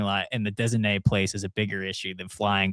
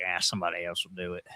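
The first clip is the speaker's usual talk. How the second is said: lower, softer lazy Bubba with cigarette in mouth; 5 semitones lower, 8 dB quieter, faster than usual. Retold or imitated. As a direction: mumble it round a cigarette in mouth. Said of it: "That's a good voice".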